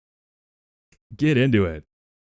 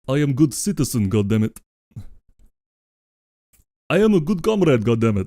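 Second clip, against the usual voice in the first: put-on Russian accent. Streamer voicing Comrade Goat in a light Russian accent. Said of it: "this accent. So let's do it in Russian".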